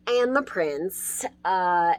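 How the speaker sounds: ordinary talk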